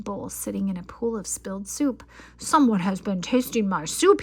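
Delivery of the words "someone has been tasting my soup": louder angry bear roar. A narrator's reading. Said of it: "he roared".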